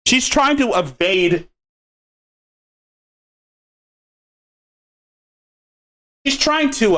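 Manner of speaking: talking through a mouthful; mouth full. Real, not acted. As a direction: speak around a bite of food. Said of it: "sorry, I have food in my mouth".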